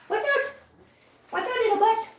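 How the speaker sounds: high playful cry of watch out; playful squeal. Pretend. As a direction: squeal it out while playing chase. Playing chase with kittens.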